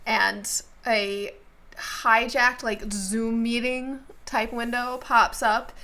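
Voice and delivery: ordinary talk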